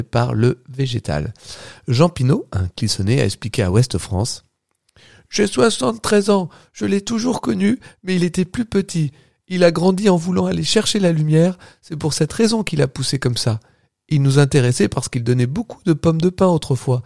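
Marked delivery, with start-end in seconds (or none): 5.3-13.6 high, strained attempt at an old man. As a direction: try to sound like a very old man, but it comes out childlike. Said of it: "cet essai de faire la voix de petit vieux".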